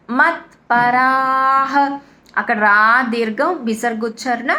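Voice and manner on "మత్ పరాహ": slow, with the visarga of పరాహ sounded as a clear ha. Shown as a demonstration. Teacher shows students the visarga, slow and clear. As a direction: pronounce పరాహ ending on an audible ha. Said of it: "హ అని పలకాలన్నమాట".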